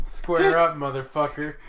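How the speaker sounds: loud mock taunt; mock tough guy. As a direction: taunt him, hammed-up loud. Mock fight challenge.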